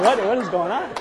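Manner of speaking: high, loud, laughing bewilderment; half-laughing, asking what on earth is happening; startled confusion. Retold or imitated. Waking up to a flooded room.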